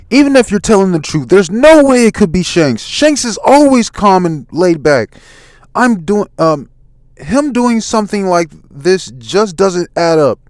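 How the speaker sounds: high, loud impassioned defence; acted indignant outburst; insistent protest. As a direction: insist loudly, pitched well up. Luffy hotly defends Shanks.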